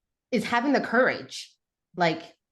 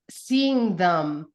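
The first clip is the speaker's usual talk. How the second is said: usual speaking voice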